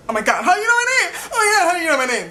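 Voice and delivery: high, fast, laughing disbelief; mock amazed. To the stand man who knows her name, laughing amazement. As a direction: gasp it like a starstruck fan. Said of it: "And I was like".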